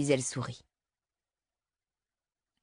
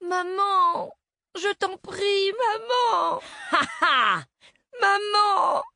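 put-on high, loud pleading little girl; a little girl begging her mother in panic; desperate child; 5 dB louder, much slower than usual. A narrator's reading. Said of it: "la fillette désespérée". (fillette désespérée, voix aiguë et suppliante)

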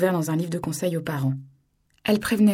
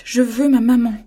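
loud, higher crying demand; tearful wail; 5 semitones higher, 10 dB louder than usual. Acted. Little girl crying out for her mother. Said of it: "en pleurs".

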